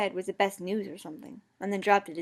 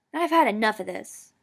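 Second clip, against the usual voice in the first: louder fed-up yell; 2 semitones higher, 8 dB louder than usual. A narrator's reading. Ron yelling that he has had enough, louder. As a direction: storm off on it. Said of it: "Ron yelled".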